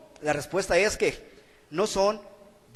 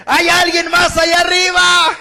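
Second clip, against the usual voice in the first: much higher, much louder desperate yell; shout; 9 semitones higher, 12 dB louder than usual. Retold or imitated. Hanging man yelling past God for someone else, panicked shout. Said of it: "y grita el hombre más fuerte".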